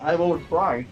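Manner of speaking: talking with mouth full. Real, not acted. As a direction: speak through a mouthful of food. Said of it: "I should not speak with my mouth full".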